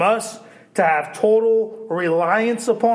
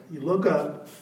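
soft reflection; 3 semitones lower, 7 dB quieter than usual. Real, not acted.

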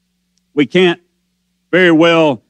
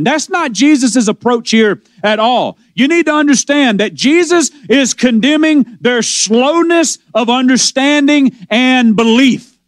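ordinary delivery

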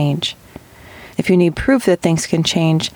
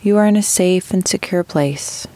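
unhurried meditation guide, long pauses between phrases; guided meditation. Acted. Host guiding listeners with chronic pain into rest, guided meditation. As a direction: ease them gently into breathing, drawing out key words.

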